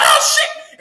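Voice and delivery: very high, laughing yelp; mock panic with a laugh in it; startled reaction. Retelling startled reaction under table.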